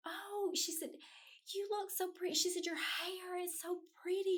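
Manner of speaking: high, sweet gushing voice; mock-sweet high praise; gushing nurse. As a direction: coo it like a kind stranger.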